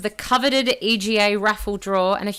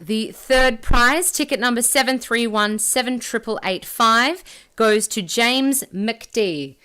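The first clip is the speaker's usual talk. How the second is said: booming prize announcer; 3 semitones higher, 5 dB louder than usual. Acted. Raffle winner announced slowly.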